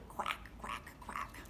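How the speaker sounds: whispered duck quack. Acted. Duck sound for kids.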